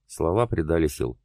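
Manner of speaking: plain reading voice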